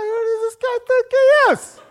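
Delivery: laughing, high offended yelp at the prosecutor; high-pitched indignation. Retold or imitated. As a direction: let your voice jump up with outrage. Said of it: "your voice goes higher".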